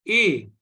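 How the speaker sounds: A as a pure "ay"; accented E sound. Shown as a demonstration. Teacher names the similar sound, A said as "ay". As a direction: say A firmly, falling, like French é. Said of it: "the sound".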